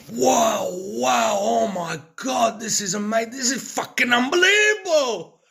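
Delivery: imitating an ecstatic, high-pitched scream; screaming in amazement. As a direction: act out a man yelling at the top of his lungs, overwhelmed with wonder. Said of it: "he was screaming through the top of his lungs".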